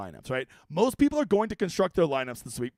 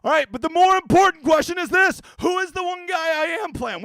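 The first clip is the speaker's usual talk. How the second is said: loud, high showman build-up; hype announcer; 11 semitones higher, 9 dB louder than usual. Acted. Hyping the pick to viewers, loud showman voice. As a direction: announce it like a game show host.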